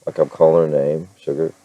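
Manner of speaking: normal talking voice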